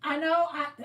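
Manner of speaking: ordinary talk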